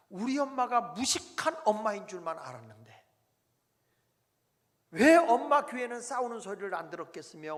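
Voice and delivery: fervent sermon climax; preaching at full force. Acted. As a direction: drive the point home from the pulpit.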